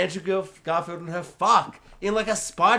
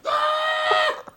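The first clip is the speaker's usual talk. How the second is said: excited shriek; much slower than usual. Real, not acted. Fangirling over Spider-Gwen, high excited squeal. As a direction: squeal with delight.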